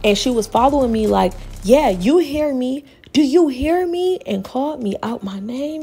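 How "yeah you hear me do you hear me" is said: imitating a ranting stranger, higher and louder, slow; hammed-up woman demanding to be heard; retelling a stranger's taunts, higher and louder; mock confrontation.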